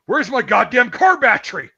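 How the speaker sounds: put-on loud, high furious yell at a kid; retold angry yell. Great uncle bursting into the ice house, yelling at the boys. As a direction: bellow it like an enraged great uncle. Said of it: "He's like".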